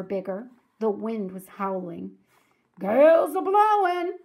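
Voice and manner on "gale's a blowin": storm warning call. Acted. Pinky warns of the howling wind, hollering. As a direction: cry it out over the wind.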